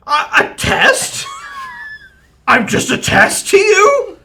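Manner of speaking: loud, high offended troll cry; wounded troll wail. Acted. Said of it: "he says".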